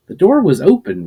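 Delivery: plain reading voice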